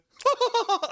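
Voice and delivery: high, loud mock chuckle; imitated laugh. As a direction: cackle up high like the interviewer does. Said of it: "like that laugh".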